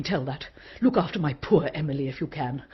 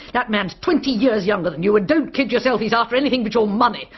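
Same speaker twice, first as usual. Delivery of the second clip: raised, loud blunt warning; retelling her outburst, loud and blunt; loud retold scolding; 6 semitones higher, 4 dB louder than usual. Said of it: "I told her".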